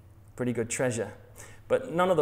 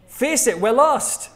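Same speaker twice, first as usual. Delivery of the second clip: higher and louder, fed up; exasperated outburst; 9 semitones higher, 9 dB louder, slower than usual. Acted. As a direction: snap it at the driver who won't admit it. Acted couple quarrel in car.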